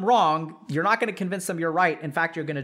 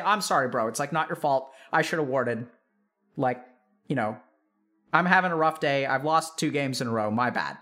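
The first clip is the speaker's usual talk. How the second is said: acted climb-down, lower in pitch; apologizing; 4 semitones lower than usual. Player apologizing to a teammate after flaming, low-key contrite. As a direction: own up, letting the pitch sink. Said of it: "and then I'll apologize".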